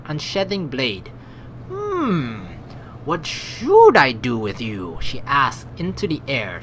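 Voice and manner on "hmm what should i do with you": slow, musing villain; theatrical villainy. Acted. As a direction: toy with your prisoners aloud. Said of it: "theatrical villainy way".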